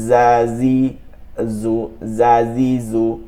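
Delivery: জাজিজু slowly, each j a buzzed z with drawn-out vowels; buzzing z. Shown as a demonstration. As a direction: model জাজিজু as zaa-zee-zoo, stretched out. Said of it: "এরকম একটা শব্দ আসবে". (জাজিজু টেনে, জ-এ ঝিঁঝিঁ শব্দে)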